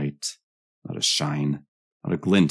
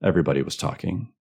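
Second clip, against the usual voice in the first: ordinary delivery, nothing marked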